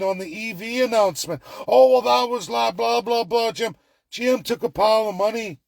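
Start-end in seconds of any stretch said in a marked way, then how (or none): 1.7-3.7 high, dismissive blah-blah imitation; mock complaint; mocking commenters. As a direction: parody whining commenters in a raised pitch. Mocking people commenting online.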